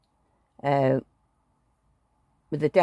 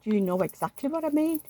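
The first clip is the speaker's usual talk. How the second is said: higher, pointed scold; mock scolding; 9 semitones higher than usual. Wife scolding husband who plays dumb, higher. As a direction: pin him down, pitched up.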